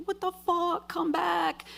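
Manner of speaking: high-pitched mock yell, volume held back; retold yelling. Yelling after the driver leaving with her bag, mock yell. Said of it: "so I'm yelling".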